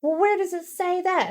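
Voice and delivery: high whiny customer voice; mocking customer voice. Imitating a clueless customer to a friend, high whiny voice.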